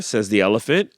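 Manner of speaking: plain reading voice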